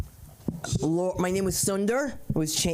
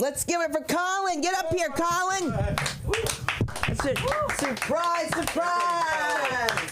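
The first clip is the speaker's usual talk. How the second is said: calling up next comic; 10 semitones higher, slower than usual. Real, not acted.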